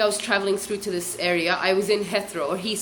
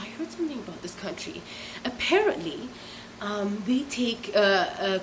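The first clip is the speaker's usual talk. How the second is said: hushed, much softer gossip; retold whisper; 6 dB quieter than usual. Imitating the smoker confiding a rumour, hushed half-whisper. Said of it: "And she starts whispering".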